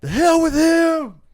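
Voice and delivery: high, drawn-out full-voice yell; acted yell. Son rejecting absent dad to Uncle Phil, acted yell. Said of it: "and he yells at him".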